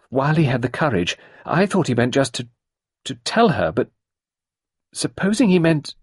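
put-on a flustered young woman confessing; embarrassed young woman recalling; Sarah, young woman. A narrator's reading. Sarah despairing.

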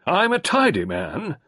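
high, loud, fast clipped colonel; Carbery demanding action, high loud colonel; gruff colonel. A narrator's reading. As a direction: bark it like an impatient old soldier. Carbery's plea.

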